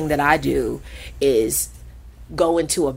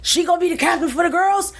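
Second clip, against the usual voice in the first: much higher, fast bossy voice; Yogi assigning captains; 13 semitones higher, faster than usual. Retold or imitated. Owner Yogi naming the captains, high and fast. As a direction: rattle off the orders like the owner. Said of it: "She was like".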